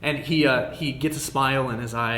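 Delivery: ordinary talk, nothing marked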